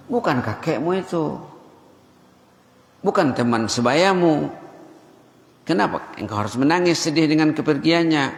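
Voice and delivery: parent questioning. Retold or imitated. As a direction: parent asking why the child weeps.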